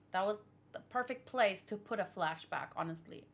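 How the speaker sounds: ordinary talk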